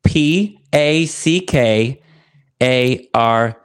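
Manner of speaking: slow, letter by letter; spelling it out. Shown as a demonstration. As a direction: spell each letter clearly. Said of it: "can you spell that [name]".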